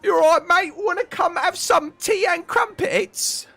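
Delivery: very high, hammed-up English accent; American's fake English accent. Streamer imitates Americans inviting someone round, hammed-up English accent. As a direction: parody an American attempting an English accent, slowing at the end. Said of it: "an impression of an English person".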